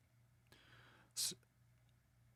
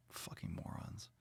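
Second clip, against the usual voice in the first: hissed under the breath, slow; whispered insult; 5 semitones lower, 21 dB quieter than usual. Real, not acted. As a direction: mutter it to yourself in disgust. Muttered insult after gym story.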